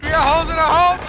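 yelling. Real, not acted. Ship flooding, panicked yelling.

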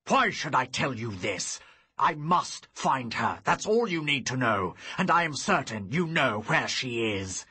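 loud, rising wrath; wrathful. Acted. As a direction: let the anger flare back. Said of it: "his wrath suddenly returned".

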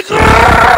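high, loud rapper growl grrr; demo growl. Shown as a demonstration. Host demoing a rapper ad-lib, loud growl. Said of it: "No, say".

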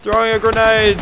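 loud warning shout; calling out. Real, not acted. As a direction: shout it to the squad. Warning teammates of grenade.

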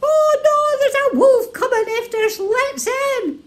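high, loud startled pig; high alarmed pig at the door; alarmed third pig. A narrator's reading. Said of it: "and said".

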